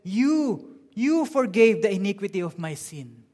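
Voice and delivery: "you" pitched high, loud and repeated; ringing emphasis on "you"; stress on YOU. Shown as a demonstration. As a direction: hit "you" hard, with excitement. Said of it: "has an emphasis on the word "you".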